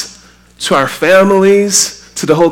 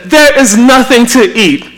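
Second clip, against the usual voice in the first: a mock whine, a bit higher and louder; whining complaint; 7 semitones higher, 4 dB louder than usual. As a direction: whine like you're staring into the fridge. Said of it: "and said".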